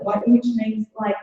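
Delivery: ordinary talk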